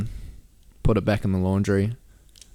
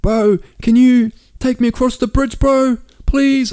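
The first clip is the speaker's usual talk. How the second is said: much higher, pleading mock voice; begging stranger; 8 dB louder, slower than usual. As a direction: whine it like a desperate stranger.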